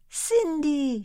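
high, bright call of the name; the fairy calling Cinderella. A narrator's reading. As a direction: sing out her name. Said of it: "she cried".

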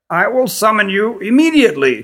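high, reedy queen's voice; reedy-voiced queen. A narrator's reading. Queen sending visitors to rest, high and reedy. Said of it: "in her reedy voice".

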